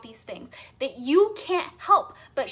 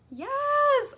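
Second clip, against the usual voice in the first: excited yes; 12 semitones higher, 3 dB louder than usual. Real, not acted. Enthusiastic agreement.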